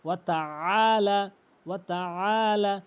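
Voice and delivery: আলা squeezed from mid-throat and stretched; correct throaty ayn. Shown as a demonstration. Teacher repeating for learners, pharyngeal long আলা. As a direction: hold আলা with a tightened throat. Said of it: "বলতে হবে".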